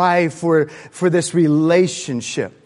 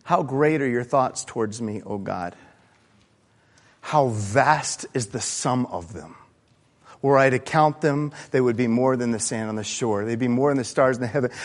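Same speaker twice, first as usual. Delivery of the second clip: softer, hushed scripture reading; reverent reading; 4 semitones lower, 5 dB quieter than usual. Acted. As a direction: read the psalm quietly with awe. Reading Psalm 139 reverently.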